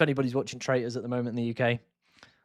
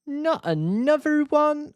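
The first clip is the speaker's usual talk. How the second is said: much higher, slow put-on Scottish accent; mock Scottish accent; 13 semitones higher, slower than usual. Quoting the Traitors woman in a high Scottish accent. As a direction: squeak it out in a Scottish voice. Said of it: "I thought she was Scottish".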